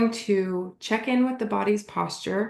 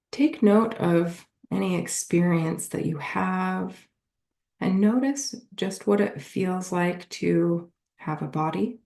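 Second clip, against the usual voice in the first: guided meditation; 3 semitones lower than usual. Acted. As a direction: lead them gently through a body scan, unhurried.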